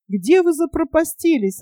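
a touch of breathless-news excitement; excited jabber. A narrator's reading. Boy finds his missing friends, mildly excited jabber. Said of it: "затараторил он".

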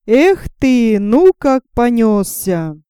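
ordinary delivery

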